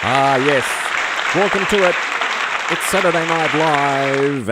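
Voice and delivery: high, loud, grand host welcome; showy talk-show host. Pretend. Host welcoming viewers to his live show, grand and loud. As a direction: greet the crowd like a late-night TV host.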